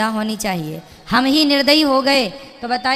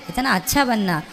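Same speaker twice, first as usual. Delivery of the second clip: ordinary talk, nothing marked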